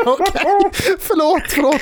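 very high, giggling sorry; laughing mock apology. Apologising to the drunk, laughing and very high. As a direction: squeak out apologies through laughter. Retold own flustered reply.

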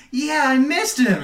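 put-on sweet kid reply; mock child voice. Retelling the game scene, boy Jack in a high sweet voice. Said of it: "And he's like".

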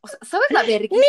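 high, loud laughing outcry; laughing shout. Real, not acted. She recalls her parents finding the printed story, laughing shout. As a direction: yell it through laughter. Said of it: "laugh shout".

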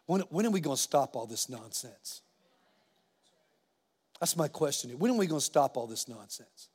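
low, subdued, heavy-hearted; somber. Real, not acted. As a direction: speak softly and gravely of a real loss. Recounting funeral of murdered young man.

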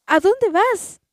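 high, loud call after the runaway; mother shouting. A narrator's reading. Mother calls after the escaping tortilla, shouting. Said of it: "gritó la mamá".

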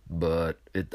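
usual speaking voice

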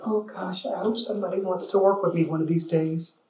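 soft, timid mock mousy voice; little mouse. Agent hoping somebody will work with them, timid mouse. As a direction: shrink it down, meek and small. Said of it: "the little mouse who's just like".

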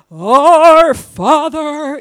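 booming, drawn-out churchy intoning; pompous prayer voice. Retold or imitated. Mocking showy pray-ers, loud overblown intoning. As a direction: ham up a showy public prayer. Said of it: "they just put on this, like, it has to be, like, this big show".

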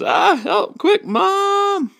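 put-on very high, loud kid's shriek; hammed-up falsetto panic; panicked cry for help. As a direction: squeal for Mom in cartoon terror. Calvin calls for help in panic.

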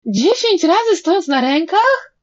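high, loud chorus-like wail of disbelief; horrified howl. A narrator's reading. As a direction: wail it as an outraged question. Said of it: "Zawyli juniorzy". (przerażone wycie z niedowierzaniem)

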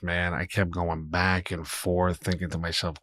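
ordinary talk

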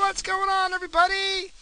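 loud, high announcer hello; high hyped greeting. Shown as a demonstration. Host greeting his listeners with a high, loud hype. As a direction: pump up the crowd. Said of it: "Is that a better opening?".

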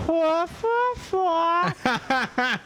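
Charlie Brown teacher garble. Retold or imitated. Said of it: "Como la maestra de pinche Charlie Brown".

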